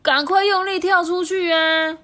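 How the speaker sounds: high, loud frog cry; urgent shout. Acted. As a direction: yell to jump out fast. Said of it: "他们就说".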